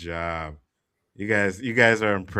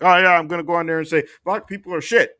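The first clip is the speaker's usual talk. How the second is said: higher, louder, fast mock comic voice; mocking hack comic; 7 semitones higher, 5 dB louder, faster than usual. Imagining himself trashing his own people, hammed-up high fast voice. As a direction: ham up a sellout comedian on stage. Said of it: "to be able to be like".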